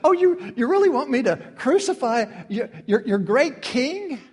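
high, loud sarcastic taunt, slowing at the end; sardonic smile. Retold or imitated. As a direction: draw out the mocking question with a smile in the voice. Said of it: "this sardonic smile on his voice".

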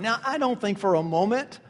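normal talking voice

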